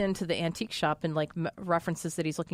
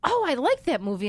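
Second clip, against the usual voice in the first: mom; 7 semitones higher than usual. Retold or imitated. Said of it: "And she's like".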